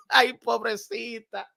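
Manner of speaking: usual speaking voice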